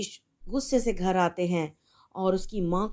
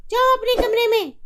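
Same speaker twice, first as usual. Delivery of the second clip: much higher, louder, fast scolding; furious mother; 13 semitones higher, 7 dB louder than usual. Acted. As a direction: chase the daughter away. Mother yells.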